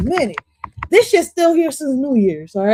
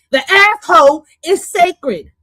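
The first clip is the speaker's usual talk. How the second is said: slow, higher, louder preacherly stand-up delivery; Cat Williams; 7 semitones higher, 4 dB louder, slower than usual. Retold or imitated. Streamer repeats the line doing a Cat Williams bit, slow and loud. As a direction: draw out each word like a comic sermon. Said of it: "Now I sound like fucking Cat Williams".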